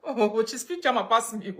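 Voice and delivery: high, loud, laughing exclamation; amazed mock reaction. Playing someone who hears her sister speak German, mock astonishment. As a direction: exclaim it loudly in playful amazement. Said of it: "you be like".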